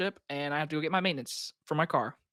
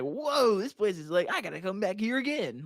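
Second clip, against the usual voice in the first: higher-pitched, hammed-up wowed diner; mock amazed reaction; 3 semitones higher than usual. Quoting an imagined diner who loved the burger place, mock amazed. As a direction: play at being blown away by a place.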